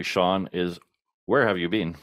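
ordinary talk

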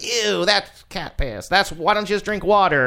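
sneering high disgusted snob; mock snob. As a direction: act out a snooty beer snob, drawn out. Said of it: "they'll be like".

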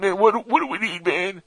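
high-pitched stoner sidekick; surfer voice. Acted. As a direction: play the second pothead alien. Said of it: "Maybe not with that exact surfer voice".

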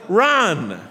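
loud, high rallying cry; imitated yell. Preacher voices Shadrach and friends in the stands, yelling. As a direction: yell it to spur a runner on. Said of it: "standing in the no smoking section yelling".

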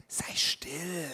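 whispered shushing, barely voiced; hushing whisper. Crowd whispering at the blind man to hush. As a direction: hiss it like a crowd silencing someone. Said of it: "Und die Leute um ihn herum sagten".

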